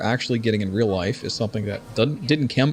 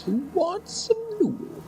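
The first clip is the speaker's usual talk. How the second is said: NPC imitation; 6 dB quieter than usual. NPC catchphrase again.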